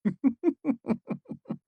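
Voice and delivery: soft throaty chuckle. A narrator's reading. Devi chuckling at the narrator's bindings. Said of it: "she chuckled deep in her throat".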